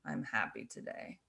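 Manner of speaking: low, level, lifeless delivery; monotone voice. Shown as a demonstration. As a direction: drop low and soft, no inflection at all. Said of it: "but I used a monotone voice".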